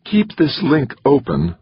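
a nervous, pressing request; with urgency. A narrator's reading. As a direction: hold on to that link for dear life. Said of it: "said Rosenberg with some urgency".

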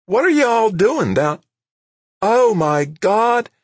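very high, loud alarmed woman's cry; high-pitched frantic exclamation; anxious, high. A narrator's reading. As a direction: shriek in shock at the sight. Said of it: "her voice anxious, high".

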